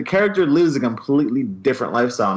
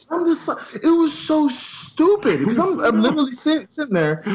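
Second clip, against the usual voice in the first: exasperated squeal; 12 semitones higher than usual. Real, not acted. Exasperated rant.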